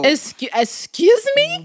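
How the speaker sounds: high, slow mock-offended question; hammed-up high-pitched indignation; indignant. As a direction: stretch it out in affronted disbelief. Repeats indignant reply to boyfriend.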